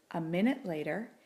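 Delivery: ordinary delivery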